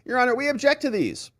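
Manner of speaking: high, squeaky mock shock; mock shocked prosecutor. Prosecutors see surprise texts, high mock outrage. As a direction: act appalled at the new evidence. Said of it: "They look at 'em and say".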